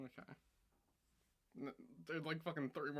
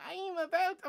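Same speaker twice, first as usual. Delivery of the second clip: imitating old self; 5 dB louder than usual. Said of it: "I'm like".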